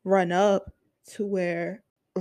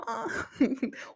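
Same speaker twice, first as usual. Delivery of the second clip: incredulous laugh; 13 semitones higher, 6 dB quieter than usual. Retold or imitated. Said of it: "I'm like".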